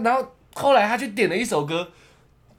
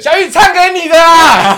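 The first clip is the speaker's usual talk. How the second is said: much higher, louder, slow mocking cheer; teasing friend; 9 semitones higher, 14 dB louder than usual. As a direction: egg him on like a rowdy friend. (朋友起哄大声调侃)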